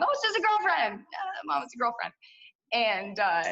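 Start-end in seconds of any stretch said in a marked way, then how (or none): 0.0-2.1 sing-song fake innocence to mom; hammed-up teen brushing it off; mock innocent teen. Teen excusing a girlfriend.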